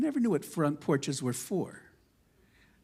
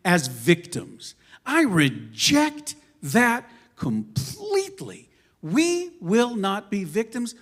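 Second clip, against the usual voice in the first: forceful speech; 5 semitones higher, 4 dB louder than usual. Real, not acted. Speaker to audience, rejecting victimhood, loud and emphatic. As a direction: hammer each refusal home.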